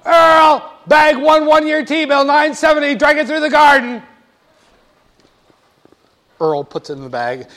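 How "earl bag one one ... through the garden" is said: loud, high call across the room. Acted. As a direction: shout the order to Earl. Hostess calls to assistant Earl.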